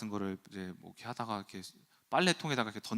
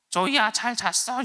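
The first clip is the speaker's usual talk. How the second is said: high, loud, drawn-out parroting toy voice; 말하는 선인장 흉내; 10 semitones higher, 6 dB louder, slower than usual. Retold or imitated. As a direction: mimic a talking cactus toy, squeaky high. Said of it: "여러분, 말하는 선인장 아세요?". (말하는 선인장처럼 높고 크게 따라하기)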